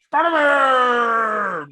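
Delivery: high, slow, drawn-out cheer; loud stretched fanboy cry; excited shout. Retold or imitated. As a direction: holler the name like the best moment of your life. Kid yelling at Marvel logo.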